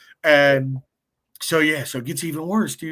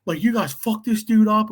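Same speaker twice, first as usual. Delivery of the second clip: angry cop; 7 semitones higher, 4 dB louder than usual. Retold or imitated. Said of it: "they're like".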